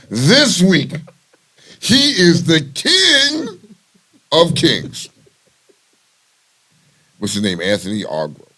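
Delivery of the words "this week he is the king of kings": high, slow, drawn-out proclamation; grand announcer. Pretend. Host crowning this week's subject, grand announcer. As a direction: proclaim it with big pauses.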